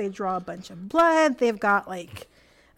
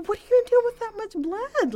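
put-on much higher, indignant kid voice; exasperated little kid; 12 semitones higher than usual. Seven-year-old protesting to the nurses, high and indignant. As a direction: play a tiny kid outraged at the needle. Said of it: "I'm like".

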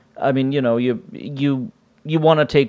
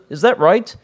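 ordinary talk, nothing marked

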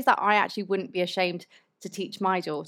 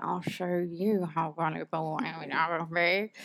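drawn-out, softer mock American accent; American accent, inner voice; 3 semitones lower, 5 dB quieter than usual. Repeating the film line in her head, drawn-out American accent. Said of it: "that's literally what I kind of said in my head".

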